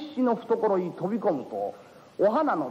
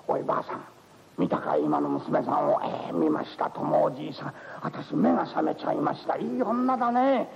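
put-on old couple chatting; 3 semitones lower than usual. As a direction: murmur fondly like an old married pair.

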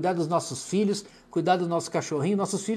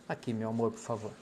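quiet aside; 8 semitones lower, 9 dB quieter than usual. Real, not acted. Asks wife to button coat.

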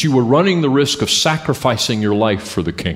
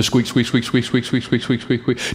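squeaky brakes imitation; 3 dB quieter than usual.

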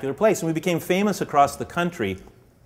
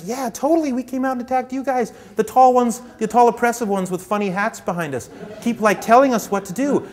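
much higher, eager pawn agreeing; excited pawn; 10 semitones higher, 4 dB louder than usual. Retold or imitated. Second pawn complaining about the tall ones, excited and high. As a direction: gush it out like an excited kid. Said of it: "the other one's like".

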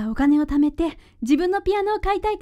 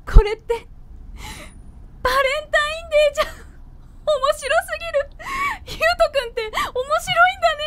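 words squeezed out through high laughter; laughing hard; 10 semitones higher than usual. Real, not acted. Girlfriend laughing at a Valentine's chocolate Christmas gift, giggling. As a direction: crack up mid-sentence. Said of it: "そんなに笑うなよ".

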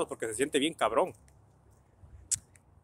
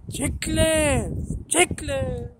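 high, drawn-out street call; long high-pitched yell, louder; shouting a nickname; 13 semitones higher, 4 dB louder, much slower than usual. Retold or imitated. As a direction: holler for a pal down the street. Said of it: "Que me grita".

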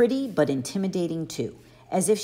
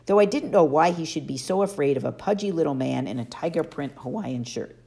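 ordinary delivery

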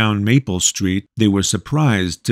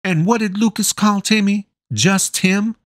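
much higher, firm motherly insistence; bossy, doting mother; 12 semitones higher than usual. A narrator's reading. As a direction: brook no argument, a big mom in charge. Voices the mother Gretchen.

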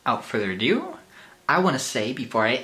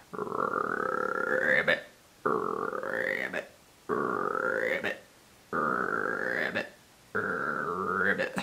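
much lower, croaking ribbits; frog croak; 7 semitones lower, much slower than usual. Acted. Vlogger doing animal voices, deep croaking frog. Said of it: "do a frog".

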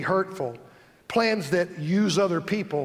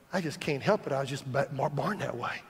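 soft excuse-making murmur; 5 semitones lower, 5 dB quieter than usual. Retold or imitated. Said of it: "he whispers".

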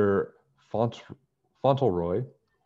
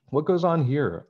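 put-on Russian accent demand; 8 semitones higher, 5 dB louder than usual. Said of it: "a voice with a Russian accent demanded".

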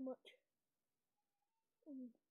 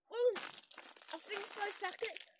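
higher, louder boast; excited; 6 semitones higher, 7 dB louder than usual. Real, not acted. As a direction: announce it proudly. Proud announcement.

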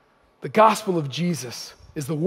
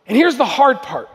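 normal talking voice

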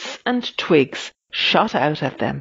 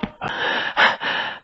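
breathless puffing; panting; 4 dB quieter than usual. A narrator's reading. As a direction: pant hard from hurrying. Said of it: "panted Windbag".

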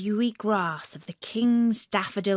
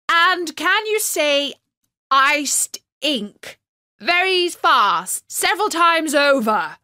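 jester voice; 7 semitones higher, 7 dB louder than usual. A narrator's reading. Jester asking a tongue twister.

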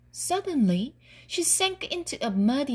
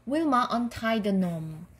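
ordinary delivery, nothing marked

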